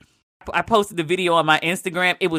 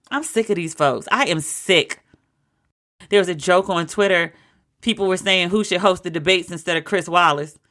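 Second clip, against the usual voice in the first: ordinary delivery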